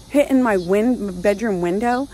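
usual speaking voice

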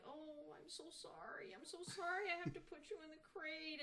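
high, soft, sorry voice; overly sorry owner cooing to her dog; apologetic self. Retold or imitated. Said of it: "I would be like".

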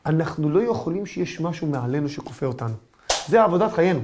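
ordinary talk

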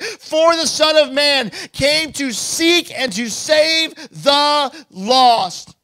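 slow, proclaimed scripture quote ending in a shout; scripture declared aloud; quoting Jesus. Retold or imitated. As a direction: read the verse like a herald, building to a cry. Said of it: "Jesus himself said about himself".

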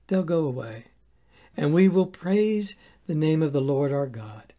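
usual speaking voice

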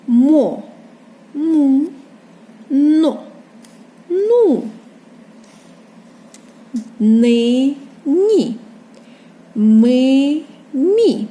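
Мо, му, но, ну sounded loudly one at a time; reading syllables aloud. Shown as a demonstration. Teacher reading on for children, Мо, му, но, ну loud and separate. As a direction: stretch each syllable like Ни, ні, with pauses. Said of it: "Читаємо далі".

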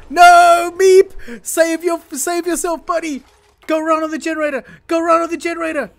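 high, loud near-screaming plea; panicked shout. Real, not acted. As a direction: scream at the little guy to save himself. Panicked yelling at dying colonist.

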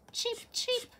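high chirpy chip chip; sparrow chirp. Acted. Sparrow second time.

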